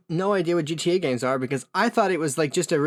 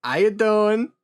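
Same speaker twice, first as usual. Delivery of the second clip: mock Italian-American "How you doing?", higher and louder; Italian-American greeting; 7 semitones higher, 4 dB louder, slower than usual. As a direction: lay on a New York Italian charm. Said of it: "God, that's so Italian".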